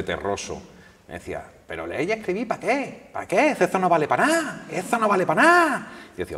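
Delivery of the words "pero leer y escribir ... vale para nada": put-on high, loud Andalusian accent of a gitano singer. Flamenco singer scoffing at reading to the reporter, Andalusian accent. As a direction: scoff it in a rough Jerez Andalusian accent, pitched up. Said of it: "con su acento así".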